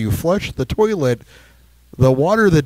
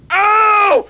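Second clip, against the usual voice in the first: high mock scream of pain; high drawn-out "oh" in imitation; imitated scream. Imitating Chris hurting himself on the car, screaming. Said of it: "he's screaming".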